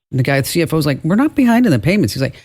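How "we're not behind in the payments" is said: indignant denial. Retold or imitated. CFO protests to the fake CEO, indignant and quick. CFO denies being behind.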